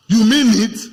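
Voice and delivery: "You mean it?" thrown out loud as a stunned question; loud repeat. Shown as a demonstration. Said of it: "You say".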